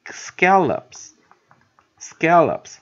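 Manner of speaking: SCALLOPS loud and slow, weight on the first syllable; slow pronunciation demo. Shown as a demonstration. Teacher introducing the next word, slow pronunciation demo. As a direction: model SCALLOPS high and drawn out, pausing between repeats. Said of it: "下一个".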